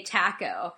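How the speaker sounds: taco as "TACK-oh"; taco with a short a as in cat; British pronunciation. Shown as a demonstration. Describing what Brits all say, taco as TACK-oh. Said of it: "they all say".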